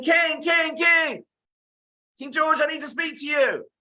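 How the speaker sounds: very high, drawn-out shouts for attention; high-pitched boy's frantic call; desperate shouting. Acted. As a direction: yell it across a crowd to the king. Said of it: "he's still shouting and he's crying".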